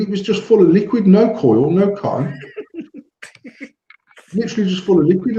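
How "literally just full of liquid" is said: laughing through the words, higher and fast; can't keep a straight face telling it. Real, not acted. Laughing while telling the listener about the customer.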